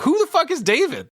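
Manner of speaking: put-on high-pitched confused kid blurting out; imitating Sam. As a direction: yelp it like a bewildered little brother. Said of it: "Sam's like".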